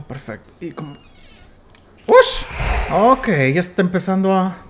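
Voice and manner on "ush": startled gasp. Real, not acted. Startled by game noise.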